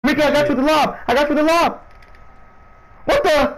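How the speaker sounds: high-pitched call to a teammate; excited in-game callout; urgent calling out. Real, not acted. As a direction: shout it high, insisting. Calling to teammate during play.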